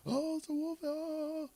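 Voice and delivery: put-on high, panicky falsetto boy; boy crying wolf. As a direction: shriek it up high like a scared kid. Boy crying wolf last time.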